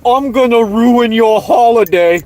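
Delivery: high-pitched hammed-up British accent; mock British accent. Ranter throwing an online troll's threat back, mocking British accent. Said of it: "with your British accent having ass".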